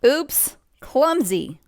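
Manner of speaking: high, loud startled oops then tut; playful gasp at a spill; a storyteller's mock dismay at the clumsy painter; mock surprised oops. Paint spill in read-aloud story.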